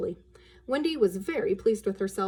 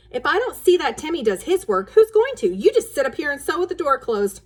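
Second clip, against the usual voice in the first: loud, high back-talk; sassy girl; 6 semitones higher, 6 dB louder than usual. Acted. Daughter sasses her mother, high and loud. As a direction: talk back like a bossy brat. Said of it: "Wendy said".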